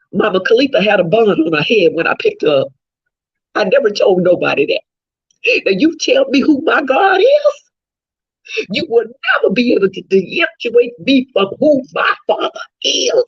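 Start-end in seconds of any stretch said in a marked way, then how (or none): 5.7-13.2 a grieving mother's tearful challenge; crying shout. Real, not acted. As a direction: cry it out in defiance. Grieving mother defies the crowd.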